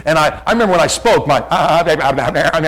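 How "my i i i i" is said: stammering nervous first speech, acted out; imitated shaky nerves. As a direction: stumble on the first words, mimicking nerves. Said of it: "my voice was shaking".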